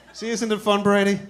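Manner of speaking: ordinary delivery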